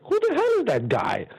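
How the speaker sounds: a retold why-is-he-here squeak; incredulous outburst. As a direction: toss it up high like a baffled caller. Incredulous officials phoning around.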